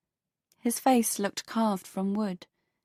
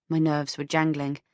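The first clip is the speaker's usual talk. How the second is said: usual speaking voice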